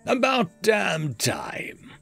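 a hint of a bored assassin; lazy sarcasm. A narrator's reading. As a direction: drawl it out like you've waited forever. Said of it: "at a leisurely pace".

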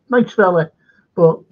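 ordinary talk, nothing marked

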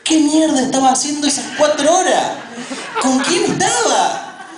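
high-pitched, paranoid demand for answers; jealous outburst. Acted. Paranoid partner stewing over a text answered hours late, jealous outburst. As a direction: interrogate an absent partner in a strained, high voice.

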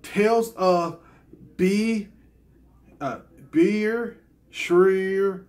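halting, drawn-out attempt at "Beer Shreer"; careful pronunciation. Shown as a demonstration. Vlogger trying to get a game title right, careful pronunciation. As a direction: sound out "Beer Shreer" slowly, syllable by syllable. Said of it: "let me try to get it right".

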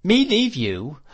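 high, loud incredulous question; affronted exclamation, leaping up in pitch; running on. A narrator's reading. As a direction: scoff at the very idea. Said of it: "went running on in her old fashion".

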